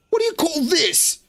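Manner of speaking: high mock soldier voice; NPC quest-giver voice. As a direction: read it like a game character.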